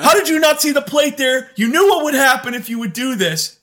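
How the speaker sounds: high, loud exasperated parent voice; scolding dad. Retold or imitated. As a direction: scold the kid like an exasperated father. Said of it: "I'm like".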